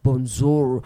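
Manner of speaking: "bonjour" said bon-ZOR, with a z; Seychellois greeting. Shown as a demonstration. Host shows listeners good morning in Seychelles, z-sound pronunciation. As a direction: say "bonjour" with z in place of the French j. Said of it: "Z O U R".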